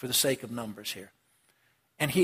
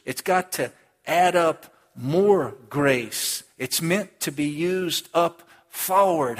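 slow, louder preaching cadence; deliberate pulpit delivery; preaching emphasis; 5 dB louder, much slower than usual. Acted. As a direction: drive the point home slowly. Preaching climax.

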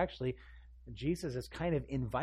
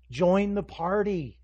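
louder, higher, slow plea; insistent urging; 6 semitones higher, 8 dB louder, slower than usual. Acted. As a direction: press the invitation harder, loud and drawn out. Jesus urging, insistent repeat.

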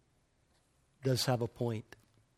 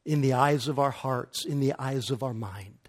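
usual speaking voice

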